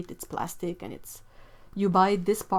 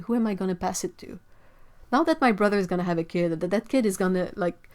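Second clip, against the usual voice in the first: normal talking voice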